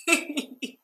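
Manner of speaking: loud high laugh breaking through; burst of laughter. Real, not acted. Laughing out loud at Sheree's dancing. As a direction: crack up laughing at the memory.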